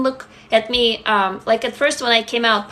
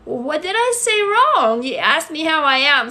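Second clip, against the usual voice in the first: much higher, slow bewildered mock protest; puzzled, hands-up what-did-I-do; baffled protest; 5 semitones higher than usual. Newcomer confused by the stranger leaving, high slow bewildered protest. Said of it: "And I was like".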